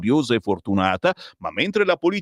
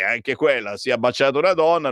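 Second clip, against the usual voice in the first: ordinary delivery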